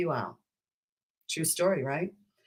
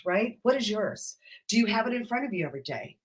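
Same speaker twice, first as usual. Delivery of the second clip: usual speaking voice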